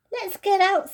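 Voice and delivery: Edmund's voice. Acted. Edmund complains to the others about the camphor, disgusted. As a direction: wrinkle your nose at the smell.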